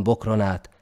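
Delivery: usual speaking voice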